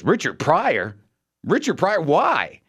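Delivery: "Richard Pryor" repeated in disbelief, pitch swooping up; mock-incredulous resident querying "Richard Pryor"; baffled echo. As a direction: echo "Richard Pryor" as a puzzled question, then demand why.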